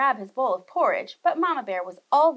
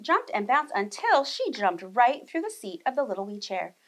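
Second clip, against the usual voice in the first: usual speaking voice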